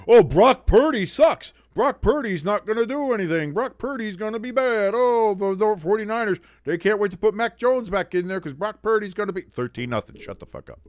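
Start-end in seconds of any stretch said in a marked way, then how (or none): 0.1-9.4 high-pitched whiny mimicry of complainers; mock hand-wringing, pitched way up; mocking doomsayers. Mocking what everybody said. 10.2-10.8 low, hushed aside; unvoiced-sounding whispered put-down; muttered retort. Real, not acted.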